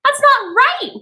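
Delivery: high anguished wail. A narrator's reading. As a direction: cry out against it, half in tears. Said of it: "wailed Missus Bowles".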